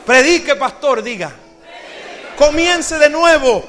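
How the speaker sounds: full-throated shout. Shown as a demonstration. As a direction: call it out with real fire. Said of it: "pero con dolor".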